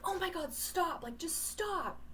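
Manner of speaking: retold high, loud yell of a fed-up older sister; exasperated yell. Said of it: "and I was like".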